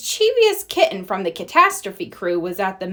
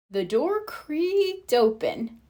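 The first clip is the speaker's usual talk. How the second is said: ordinary delivery, nothing marked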